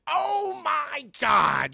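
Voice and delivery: high, slow, drawn-out shock; stunned gasp. Acted. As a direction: let the reveal hit him. One One stunned by reveal.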